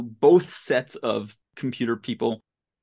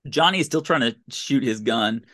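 ordinary talk, nothing marked

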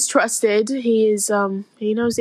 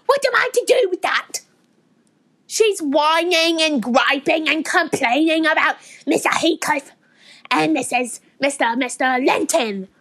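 put-on thick Yorkshire accent. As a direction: gripe away in broad Yorkshire. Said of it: "That is one thick Yorkshire accent".

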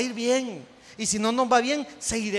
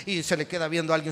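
ordinary talk

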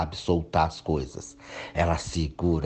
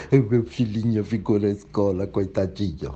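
mock whiny mother; 3 semitones higher than usual. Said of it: "E a mãe chorando, dando escândalo lá".

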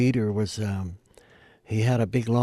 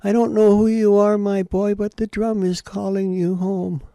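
put-on old native lady; 11 semitones higher, 6 dB louder than usual. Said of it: "And she said to me".

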